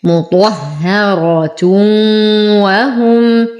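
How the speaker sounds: slow "مطهرة وهم", final t voiced with tanween humming into waw; إدغام مع غنة. Shown as a demonstration. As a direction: join "مطهرة وهم" with a long nasal w. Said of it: "فالحكم إدغام مع غنة". ("مطهرة وهم" ببطء مع إدغام التنوين بغنة)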